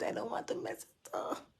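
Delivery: whispered aside, holding back tears; breathy whisper so the makeup survives; teary whisper. Real, not acted. Host fighting tears on stream, whispering. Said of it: "I did my makeup".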